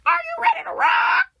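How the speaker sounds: very high, loud screamed hype call; hammed-up rock frontman launching the crowd; imitated rock-show shout. Ride's launch announcement acted.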